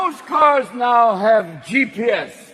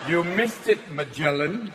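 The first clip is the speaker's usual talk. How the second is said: snooty English GPS; 2 semitones lower than usual. Retold or imitated. The English GPS scolding the driver, condescending English. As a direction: sneer it in prim English. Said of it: "bitchy English voice".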